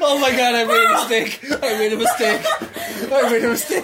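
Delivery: loud laughing after a spit take. Real, not acted. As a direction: gasp it out, laughing hard. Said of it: "Were you trying to do a spit take?".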